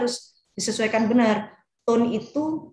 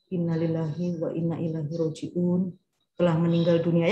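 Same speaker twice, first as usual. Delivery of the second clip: solemn condolence; 3 semitones lower than usual. Shown as a demonstration. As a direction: announce the death gently, with respect. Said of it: "kita harus benar-benar kelihatan".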